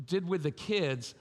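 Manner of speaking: normal talking voice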